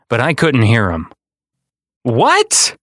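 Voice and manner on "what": high, straining call across the noise; boy calling to the departing conductor, high and straining; yelled out. A narrator's reading. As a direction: shout to be heard over the train. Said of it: "I yelled out".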